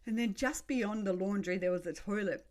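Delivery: ordinary delivery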